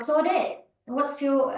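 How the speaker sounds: ordinary delivery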